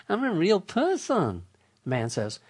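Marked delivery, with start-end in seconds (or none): 0.1-1.4 higher, cheerful canned machine voice; chirpy telemarketer robot voice; robot caller. Retold or imitated. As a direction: play back a recorded bot insisting it's human. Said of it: "repeated on the tape over and over".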